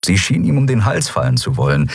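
plain reading voice

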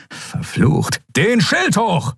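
cursed under the breath, then a high shouted command; swear, then snap the order; angry order. A narrator's reading. Admiral ordering the shield up in anger.

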